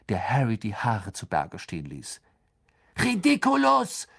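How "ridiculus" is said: high, cried-out spell; Seamus. A narrator's reading. Seamus casting at the banshee, calling out. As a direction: call the spell out at a high pitch. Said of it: "rief Seamus".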